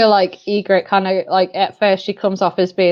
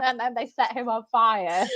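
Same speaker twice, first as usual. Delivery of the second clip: ordinary delivery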